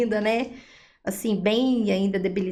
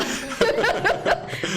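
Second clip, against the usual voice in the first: breathy joyful laugh; 12 semitones higher than usual. Real, not acted. Laugh after good news.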